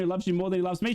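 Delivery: normal talking voice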